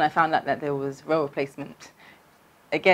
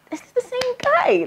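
giddy disbelief. Retold or imitated. Giddy disbelief after great date.

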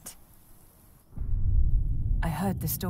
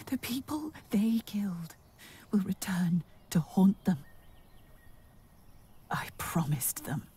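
hushed whispered vow; whispering; 4 dB quieter than usual. Acted. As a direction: whisper it like a secret promise. Quiet vow of revenge.

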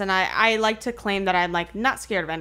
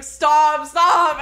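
higher, louder giggly protest; nervous laughing; 6 semitones higher, 7 dB louder than usual. Retold or imitated. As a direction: laugh it out through nerves. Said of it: "cracking up and I'm like".

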